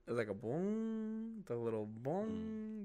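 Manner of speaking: high, slow imitation of a Windows alert; imitating alert sound. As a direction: mimic the little boom of a system alert. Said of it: "you know that Windows alert?".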